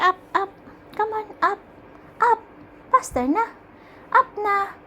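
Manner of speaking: high, bright coaxing calls, up and up; coaxing calls to cat. Real, not acted. Owner coaxing her cat to jump up, high calling. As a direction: call the cat up like a pet owner.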